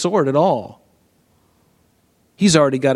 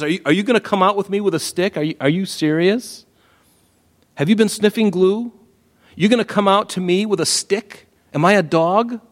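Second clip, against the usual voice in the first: mocking Goliath; 7 semitones higher than usual. Preacher voicing Goliath's mocking taunt.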